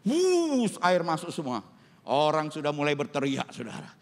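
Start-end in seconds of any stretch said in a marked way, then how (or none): 0.0-0.8 high, drawn-out whoosh; rushing water noise. Retold or imitated. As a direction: imitate water gushing in. (tiruan bunyi air menyembur)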